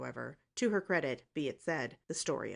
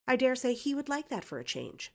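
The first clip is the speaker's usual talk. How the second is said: brazen; 9 semitones higher, 5 dB louder than usual. A narrator's reading.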